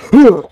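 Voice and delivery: high, loud mock shriek; short burst of hammed-up screaming; imitated protester scream. Imitating a cringy protester for the host, mock scream. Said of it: "he's, like, screaming into".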